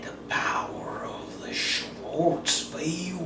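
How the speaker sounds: slow, hushed incantation; mock-mystic whisper over the ring; solemn whisper. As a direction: whisper it like a sacred spell. Reveals the ring's incantation.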